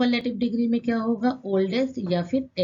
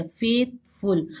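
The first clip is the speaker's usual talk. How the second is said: "Faithful" slowly, broken into फेथ and फुल; two separate beats in "faithful"; split into syllables; much slower than usual. Shown as a demonstration. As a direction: say फेथ, pause, then फुल. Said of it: "दो बार बोले".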